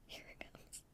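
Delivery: barely audible, trailing off; dying hush; fainter whisper. Real, not acted. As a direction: let the whisper fade to almost nothing.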